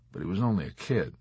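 usual speaking voice

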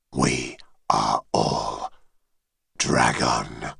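very low, distant dragon voice; deep dragon voice. Acted. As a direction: speak as an ancient dragon, far below. Dragon head speaks.

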